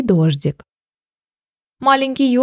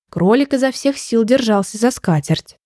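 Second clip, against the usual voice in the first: usual speaking voice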